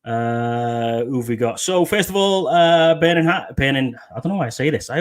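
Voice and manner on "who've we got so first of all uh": ordinary talk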